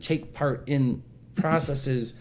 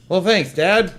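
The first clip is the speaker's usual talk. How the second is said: sarcastic thanks; 6 semitones higher, 6 dB louder, slower than usual. Retold or imitated. Sarcastic retold reply to father.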